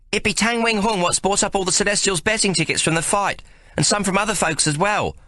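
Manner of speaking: high, lightly voiced working girl; a hint of a young cockney lass explaining; Maggie explaining.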